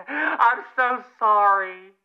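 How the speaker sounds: ordinary talk, nothing marked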